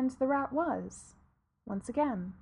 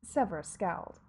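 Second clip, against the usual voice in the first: plain reading voice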